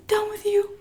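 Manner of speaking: teasing, lightly mocking; mock sing-song retort. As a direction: flick it off playfully.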